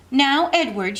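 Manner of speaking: a hint of an eager ten-year-old; little girl Abilene. A narrator's reading. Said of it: "she said to him".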